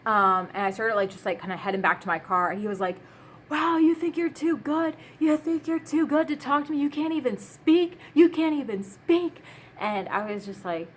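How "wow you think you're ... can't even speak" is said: much higher voice, mocking a rejected man; imitated yelled insults. Man insulting the woman who ignored him, mock yelling. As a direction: imitate his indignant pestering, pitched way up. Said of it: "you're yelling insults at me".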